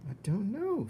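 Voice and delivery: put-on simple girl. Mig cowering.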